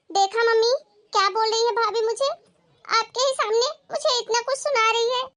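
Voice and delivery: high-pitched, aggrieved appeal to mother; whiny, scandalised complaint; indignant tattling. Acted.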